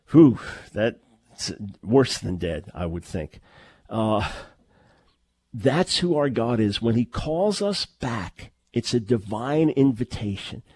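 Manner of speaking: usual speaking voice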